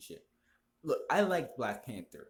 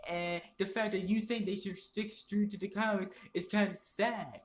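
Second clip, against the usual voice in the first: higher, whiny mock voice of a know-it-all fan; higher, whiny mimicry of an online opponent; mocking the male feminist; 7 semitones higher than usual. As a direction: parody the smug fan higher and whinier. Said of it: "and he said".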